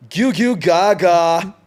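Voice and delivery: put-on high, loud, slow whiny baby voice; Emo Baby impression. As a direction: whine it like a crying emo baby. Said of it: "this is an impression of an Emo Baby".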